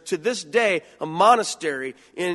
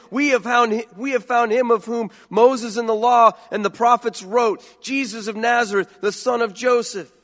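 Philip's news, voiced higher and louder; excited announcement; 6 semitones higher, 5 dB louder than usual. Philip telling Nathanael they found the Messiah, excited.

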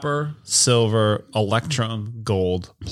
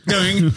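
doing noise; 9 semitones higher, 5 dB louder than usual. Retold or imitated. Echoes Peter's sound.

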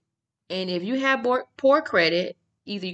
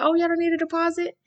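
much higher-pitched surprised question; retold shock; 11 semitones higher, faster than usual. Telling how she turned her lights on without paying, shocked. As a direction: re-enact your disbelief, voice jumping way up. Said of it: "I was shocked".

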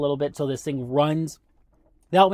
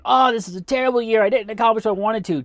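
mock-frustrated groan, pitched higher and a bit louder; frustrated lament; 7 semitones higher, 3 dB louder, faster than usual. Someone spinning their wheels at year's end, mock-frustrated complaining. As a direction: play the person moaning about a wasted year. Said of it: "frustrated, going".

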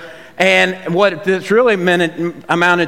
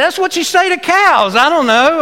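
amused insistence; 11 semitones higher, 4 dB louder than usual. Retold or imitated. Defending his cow noise, laughing.

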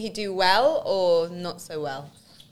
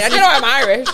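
normal talking voice

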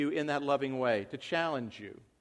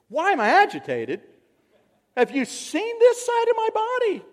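high, louder mock outrage at himself; mock indignant; 7 dB louder than usual. Husband answering wife, hammed-up high indignation. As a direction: squeal it like an offended patient. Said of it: "why are you agitated".

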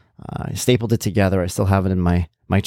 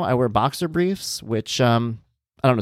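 ordinary talk, nothing marked

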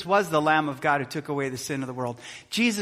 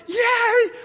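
high, loud whoop; excited cheer; 4 dB louder than usual. Retold or imitated. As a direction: shout for joy as he climbs out. Crowd cheering a survivor.

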